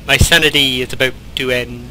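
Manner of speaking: normal talking voice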